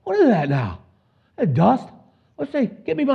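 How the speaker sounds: a lightly voiced startled king, rising high; king peering over the walls, squinting at the dust. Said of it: "can you imagine the king of Israel".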